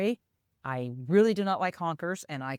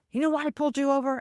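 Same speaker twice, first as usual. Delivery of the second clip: high, fast imitation of an irritated policeman; mock exasperation, rushed and raised in pitch; frustrated officer; 10 semitones higher, faster than usual. Burly officer at the car window, frustrated. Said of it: "just kinda frustrated".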